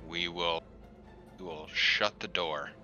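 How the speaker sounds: normal talking voice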